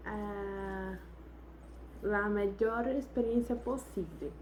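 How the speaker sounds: ordinary delivery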